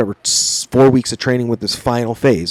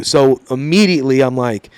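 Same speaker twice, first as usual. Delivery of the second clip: normal talking voice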